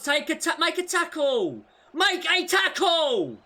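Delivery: high, loud frustrated urging; pleading shout. Real, not acted. Gamer yelling at his players to tackle.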